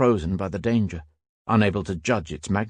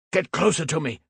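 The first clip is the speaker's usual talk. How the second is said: a raised, higher voiced shout; 8 semitones higher than usual. A narrator's reading. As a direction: bark it at the young gunner. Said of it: "Hals shouted".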